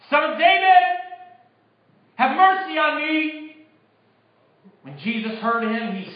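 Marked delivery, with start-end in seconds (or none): 0.1-3.6 high, loud, drawn-out plea; louder, insistent shout. Acted. Hushed beggar refuses silence and calls to Jesus, shouting louder. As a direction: shout it high over the crowd's objections. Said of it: "he only shouted louder".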